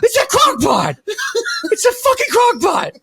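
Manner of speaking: hammed-up realisation it's the crock pot; mock panicked yell. Imagined panic over crock pot.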